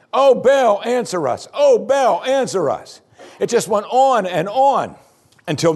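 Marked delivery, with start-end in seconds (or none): 0.1-3.0 high, loud cry on each Oh Baal, answer dropping back; repeated wailing cry. Acted. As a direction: chant it over and over, pleading. Prophets chanting on and on.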